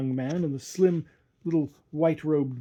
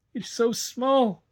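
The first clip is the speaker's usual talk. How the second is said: put-on high, slow begging boy; pleading boy; 9 semitones higher, much slower than usual. A narrator's reading. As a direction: plead it slowly, small and frightened. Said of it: "he pleaded".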